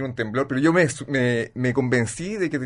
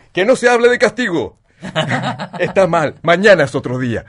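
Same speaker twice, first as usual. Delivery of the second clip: much higher, louder, hammed-up indulgent uncle; lenient uncle; 5 semitones higher, 8 dB louder than usual. Retelling the uncle forgiving the crashed car, high and loud. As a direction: play the uncle who forgives everything. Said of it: "llega un tío y dice".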